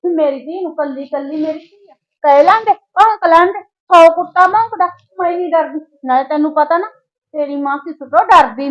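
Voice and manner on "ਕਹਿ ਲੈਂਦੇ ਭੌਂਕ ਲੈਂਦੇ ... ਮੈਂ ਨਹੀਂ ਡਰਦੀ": louder, higher, scornful defiance; defiant outburst. Acted. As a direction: fling it back at the in-laws, louder and higher. Mother defies the in-laws. (ਮਾਂ ਨੂੰਹ ਦੇ ਖ਼ਿਲਾਫ਼ ਗੁੱਸੇ ਨਾਲ ਉੱਚੀ ਬੋਲਦੀ)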